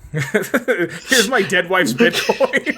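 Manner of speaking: slow, broken up by laughter; laughing joke. Pretend. Joking about a dead spouse's crypto, laughing. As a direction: struggle to get the joke out through laughs.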